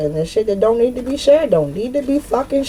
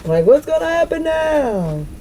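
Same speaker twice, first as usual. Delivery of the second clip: mock panic; 8 semitones higher than usual. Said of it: "I'm hearing this shit in my head".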